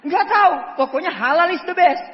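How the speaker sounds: put-on loud, high childish insistence; loud stubborn claim; stubborn shout. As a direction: insist loudly without reason.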